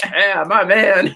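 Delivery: slow, easygoing friendly greeting; laid-back Felix greeting. Retold or imitated. Felix hails Bond on the ship, slow and easygoing. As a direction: greet Bond like an old buddy, stretched out. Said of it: "Felix is like".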